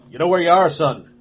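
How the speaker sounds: mustachioed guardsman barking a question; stern guardsman. Acted. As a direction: question him like a suspicious guard.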